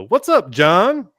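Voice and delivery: loud, high, drawn-out greeting; calling out. Real, not acted. Host greeting John mid-story, calling out. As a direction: hail them with a big rising call. Greeting someone who just joined.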